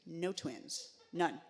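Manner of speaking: ordinary talk, nothing marked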